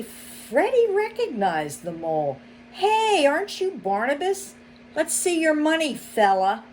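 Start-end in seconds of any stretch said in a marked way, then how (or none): 2.7-6.6 higher, quick, pushy; sly demanding fox. A narrator's reading. Fox demanding money from the mole, higher and pushy. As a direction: lean in and demand the cash.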